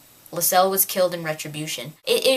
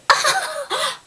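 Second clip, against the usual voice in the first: very high fake giggle; shrieky put-on titter; hammed-up high-pitched laughter; mock girlish laugh. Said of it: "She wouldn't be like".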